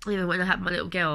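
usual speaking voice

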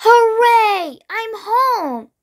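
high, jubilant storybook cheer. A narrator's reading. Greedy bee reaches the hive, high jubilant cheer. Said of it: "cheered the greedy bee".

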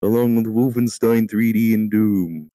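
normal talking voice

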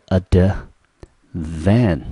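"advan" slowly split as ad, van; drawn-out "advan", a break before van; syllable by syllable. Shown as a demonstration. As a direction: sound out "advan" one syllable at a time. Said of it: "念成几个音节呢".

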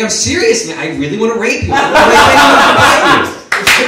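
high, insistent mock-serious flirt; mock flirting back. Stranger flirting back at comic, mock-earnest and high. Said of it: "then he'd flirt back".

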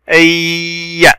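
"أي" with a long held y, ending on a short a; doubled consonant. Shown as a demonstration. Teacher showing shadda on ya, drawn-out "أي". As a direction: hold the y of "أي" very slowly, then release. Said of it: "ياء شدة وفتحة".

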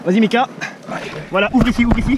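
loud urging commands; shouting. Real, not acted. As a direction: shout them forward. Directing friends loudly.

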